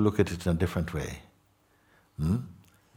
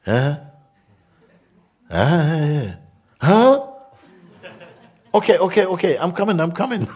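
much higher and louder, rushed calls into the phone; hurried phone answer; 11 semitones higher, 9 dB louder than usual. Retold or imitated. Teacher answers his phone to escape, loud and hurried. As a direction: grab the excuse and hurry off. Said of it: "The phone rings".

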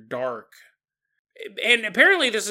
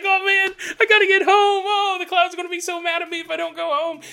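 much higher, louder frantic wail; high-pitched, mock-panicked friend rushing home; panicked whine; 13 semitones higher, 6 dB louder than usual. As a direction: fret like the streetlights are chasing you. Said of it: "He's like".

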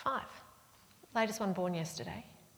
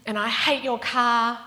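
loud, raised mock tantrum; angry child; 5 semitones higher, 9 dB louder, slower than usual. Annoyed four-year-old lashing out at the aunt, angry. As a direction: imitate a furious little kid lashing out. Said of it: "Like, just angry".

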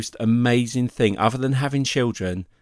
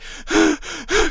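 mock exhausted gasp. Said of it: "I'm like".

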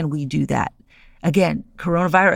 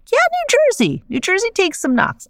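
hammed-up rally cry; mock cheer. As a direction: cheer New Jersey on like a fan. Cheering on her home state.

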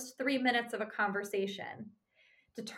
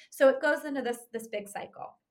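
ordinary delivery

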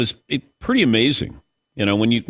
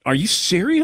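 incredulous reply; 6 semitones higher than usual. Retold or imitated. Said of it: "I go".

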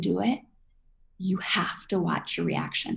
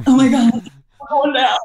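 loud, high hammed-up terror; mock panic; 6 semitones higher, 10 dB louder than usual. Nervous flyer convinced the plane is crashing, loud mock panic. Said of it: "I'm usually the one like".

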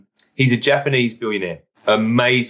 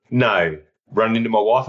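ordinary talk

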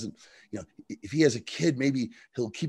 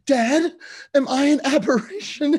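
very high, louder hammed-up daughter; after-school special melodrama; 5 dB louder than usual. Daughter asking her father about herself, hammed-up after-school special. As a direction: plead to Dad in mock melodrama. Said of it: "like something from an after-school special".

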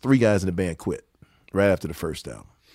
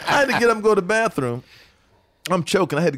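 higher and faster, through real laughter; laughing hard; 10 semitones higher, faster than usual. Real, not acted. Repeating the line to the host, laughing harder. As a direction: rush the words out while laughing.

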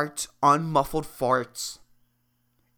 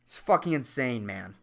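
ordinary talk

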